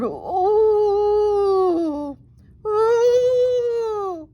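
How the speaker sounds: high, loud, long ghostly wail; banshee moan. Acted. Banshee moaning for a bunny, a high loud wail. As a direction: wail like a banshee hunting in the dark. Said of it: "as it moaned".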